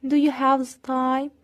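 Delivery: slow, clear question for learners; deliberate teacher pacing; slow model question. Shown as a demonstration. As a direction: model it slowly. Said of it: "slow model, then repeated".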